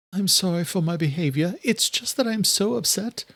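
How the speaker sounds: Adela upset. A narrator's reading. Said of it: "Adela said".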